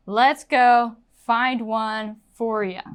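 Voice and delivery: slow model with "ya" for "you"; "for ya" linked, relaxed "yuh" at the end; reduced "you". Shown as a demonstration. Teacher shows casual talk to a person, reduced "ya". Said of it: "this you is not going to be super clear".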